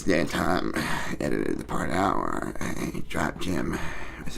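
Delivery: low, quiet, slow-starting mumbled rant; Nate Diaz. Acted. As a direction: grumble it out low and soft in a put-on voice. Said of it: "That was for you guys".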